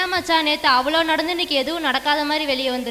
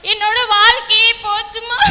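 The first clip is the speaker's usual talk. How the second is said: higher and louder, breaking down; distraught cry; 5 semitones higher, 5 dB louder than usual. Acted. Daughter telling her mother what the boys did, distraught cry. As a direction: wail that her life is ruined.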